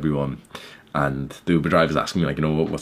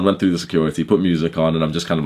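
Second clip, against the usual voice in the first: usual speaking voice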